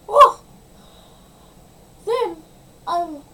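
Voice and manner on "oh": loud high startled "oh"; shocked gasp. Real, not acted. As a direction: gasp in alarm. After the spill.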